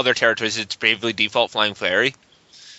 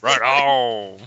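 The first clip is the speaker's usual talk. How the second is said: bad acting; 3 semitones lower than usual. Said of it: "this acting is terrible".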